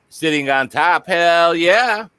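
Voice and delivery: usual speaking voice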